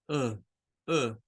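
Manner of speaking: uh as a low soft groan, no strength; a dying patient trying to speak, uh; weak sick groan. Shown as a demonstration. Teacher imitates a sick patient's groan for the schwa. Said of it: "un enfermo en una cama de hospital".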